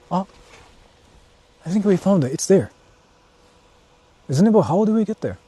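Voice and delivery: usual speaking voice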